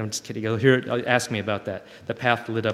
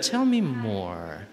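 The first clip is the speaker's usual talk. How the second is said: a slow, patient tell me more; slow gentle invitation; 2 semitones lower, much slower than usual. Shown as a demonstration. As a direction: stretch it out kindly, really inviting. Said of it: "But I do use that".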